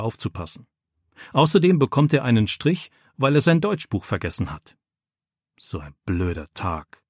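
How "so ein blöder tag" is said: leise und langsam in sich hinein gedacht. A narrator's reading. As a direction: missmutig vor sich hin denken, gedämpft. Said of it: "denkt Jakob". (leise, langsame Gedankenstimme)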